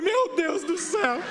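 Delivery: laughing dismay. Retold or imitated. Father reacting, laughing.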